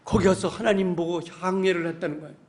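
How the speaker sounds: ordinary delivery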